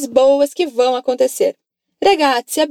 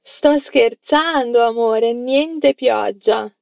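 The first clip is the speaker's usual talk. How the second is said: slow model sentence; 2 semitones lower, slower than usual. Shown as a demonstration. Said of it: "fala pra mim a frase".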